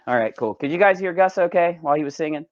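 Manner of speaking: ordinary talk, nothing marked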